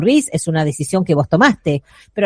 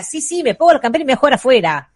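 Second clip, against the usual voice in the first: put-on hurried child; 4 semitones higher, much faster than usual. Child rushing to go play.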